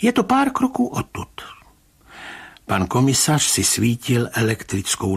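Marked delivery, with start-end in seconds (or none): 0.0-1.4 high-pitched agitated urging; trembling voice. A narrator's reading. Rybka leading the commissioner to the trail, high trembling voice. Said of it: "pravil pan Rybka rozechvělým hlasem".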